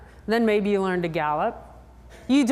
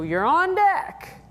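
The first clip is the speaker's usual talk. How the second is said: high, drawn-out call across the arena; steward's call; 9 semitones higher, much slower than usual. Retold or imitated. Steward calls the rider on deck, long high call. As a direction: sing it out slow like a steward calling the next rider. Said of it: "the steward yells to you".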